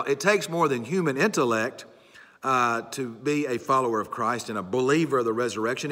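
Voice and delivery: normal talking voice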